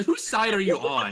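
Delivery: high, loud comic outrage; exasperated shout. Real, not acted. Demanding whose side a friend is on, exasperated shout. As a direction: yell accusingly through the teasing. Teased, challenges friend while group laughs.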